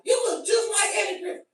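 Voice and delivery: high mocking voice for the people who say it; mock stranger. Strangers telling him he looks like Eddie Griffin, high mocking voice. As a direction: pitch it way up like an annoying stranger. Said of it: "motherfucker keep on be like".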